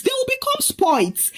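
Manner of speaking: high-pitched scolding without extra volume; scolding shout. A narrator's reading. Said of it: "and then shouted".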